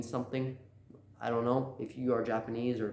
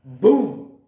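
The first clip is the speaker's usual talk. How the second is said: loud, higher-pitched boom; dramatic exclamation; 6 semitones higher, 15 dB louder than usual. Real, not acted. Storyteller dropping the big reveal, loud exclamation. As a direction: punch it out as a bombshell.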